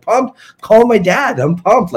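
put-on squeaky high, overexcited kid; giddy excitement. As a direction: geek out like a little kid. Retelling excitement about interviewing Arnold.

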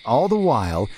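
ordinary delivery

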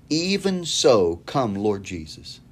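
slow, hushed reverent reading; slow reverent quote. Acted. Preacher reading John's last words, slow and reverent.

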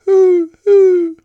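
high, loud hooting; owl's hoot. Acted. Owl calling to the spider, high loud hoot. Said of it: "asked the owl".

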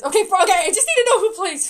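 loud, high, rushed words; frantic. Real, not acted. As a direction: stumble over yourself, desperate to find out. Frantic to learn the cast.

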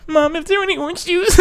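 put-on very high pleading mama's boy; whiny kid voice. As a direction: beg mom like a helpless child. Acting as a needy son.

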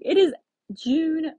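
high, drawn-out disbelief; retold amazement. Skier amazed at the date, high slow wonder. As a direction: marvel slowly in a high voice. Acting out amazement at summer skiing.

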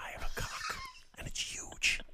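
barely voiced whisper in the ear; hushed, soft confession. Shown as a demonstration. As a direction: whisper it close and secretive. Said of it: "Yeah, whisper in his ear".

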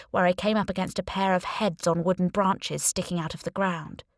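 plain reading voice

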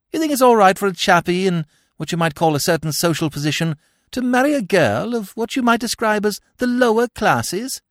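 put-on high, quick, eager young gentleman; chatty upper-class chap. Plays anxious, chatty Bertie.

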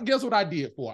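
normal talking voice

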